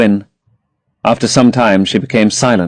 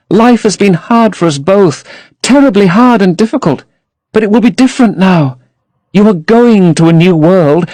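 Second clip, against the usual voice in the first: put-on higher, dreamy young girl; Sybil in love; 8 semitones higher than usual. A narrator's reading. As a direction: gush about her lover to her brother.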